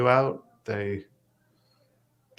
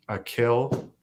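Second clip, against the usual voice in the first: normal talking voice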